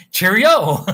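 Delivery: hammed-up British goodbye, high and loud; mock British accent. Streamer repeating British farewell to viewers, mock British accent. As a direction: call it out in a fake British accent. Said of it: "I would try to do a British accent".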